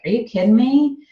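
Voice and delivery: retold scoffing disbelief; mock incredulous. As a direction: fire it back in playful disbelief. Retelling her reply to friend's teasing.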